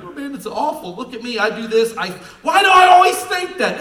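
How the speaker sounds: high, loud mock whine of a self-pitying person; mock whining. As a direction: ham up the complainer's wail. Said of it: "these people start crying like".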